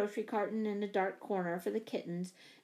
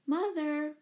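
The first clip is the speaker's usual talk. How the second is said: put-on raised, higher-pitched child's call; 6 semitones higher, 3 dB louder than usual. A narrator's reading. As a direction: call out for mother from bed. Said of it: "and called".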